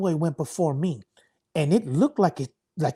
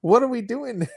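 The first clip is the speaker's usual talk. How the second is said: high, loud, half-laughing wait a minute; laughing disbelief; 6 semitones higher, 4 dB louder than usual. Retold or imitated. Wrestler retelling his shock at facing a huge opponent, laughing, high and loud. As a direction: replay your own stunned reaction with a laugh. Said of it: "And I'm like".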